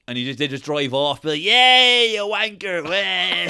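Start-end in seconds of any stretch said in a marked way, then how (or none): 1.4-3.5 high, loud whoop trailing away; hammed-up gleeful taunt; mock jeering lads. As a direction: crow as you drive off with the loot. Lads jeering as they drive off.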